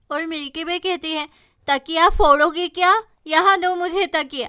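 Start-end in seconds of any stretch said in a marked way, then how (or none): 1.7-4.5 loud scolding mom; mother snapping. Acted. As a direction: tell her off loudly. Mother scolds pillow hitting. (तकिया छीनती माँ की ऊँची डाँट)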